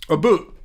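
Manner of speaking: mimicked Canadian "boot" with a long oo; imitating Canadian "about", vowel turned to oo; Canadian pronunciation. Shown as a demonstration. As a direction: say "boot" with oo where "about" has ow. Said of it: "but they say a boot".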